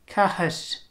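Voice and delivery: Cathis with a hissy slender R; Gaelic slender R. Shown as a demonstration. Teacher giving a Gaelic word with a slender R. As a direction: say Cathis with the whistling R. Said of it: "Gaelic slender R".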